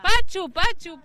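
loud call across the tent; calling for a missing clown. Real, not acted. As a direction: shout the name to find him. Host calls for missing clown.

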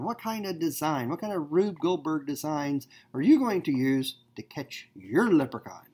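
usual speaking voice

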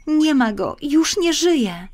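put-on high, very slow child's lament; a child telling the swallows he is gone; grieving little girl. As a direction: drag each word out like a heartbroken little girl.

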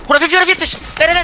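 very high frantic callout; excited shriek. Real, not acted. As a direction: shriek the numbers in panic. Shrieks callout mid fight.

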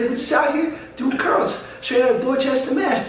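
high-pitched mock Dorchester gym guy. Host mimics a star doing curls, high mock voice. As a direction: push the celebrity parody up high. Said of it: "It's like".

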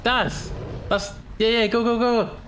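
usual speaking voice

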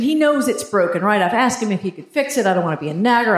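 ordinary talk

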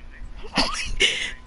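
very high, soft squeal laugh; real falsetto giggle. Real, not acted. Laughing at a friend.

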